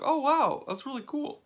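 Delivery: high-pitched retold amazement; impressed. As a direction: mimic someone pleasantly wowed. Retells staff admiring his watch.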